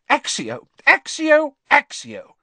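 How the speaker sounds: shouted spell, loud and high; shouting. A narrator's reading. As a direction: fling each spell out in anger. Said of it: "she shouted".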